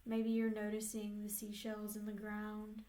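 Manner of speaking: unhurried meditation voice with long pauses; guided meditation. Real, not acted. Therapist leading clients into an inner peaceful place, guided meditation. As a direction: guide them gently, leaving space between phrases.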